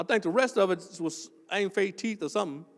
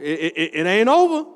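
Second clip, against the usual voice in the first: declared loud with over dropping; preacher's call; 2 semitones lower, 5 dB louder than usual. Shown as a demonstration. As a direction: punch it at the congregation. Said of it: "tell your neighbor".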